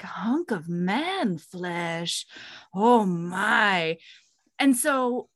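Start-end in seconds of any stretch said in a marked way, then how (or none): 0.0-4.0 low, drawn-out ogling; mock swooning. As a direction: savour it slowly, hammed-up lust. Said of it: "leaning against a tree being like".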